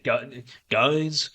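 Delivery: a lightly voiced, hammed-up Rock; mock The Rock badly, barely changing voice; awful Rock impression. Streamer doing a bad Rock impression, lightly voiced. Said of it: "It's an awful Rock impression".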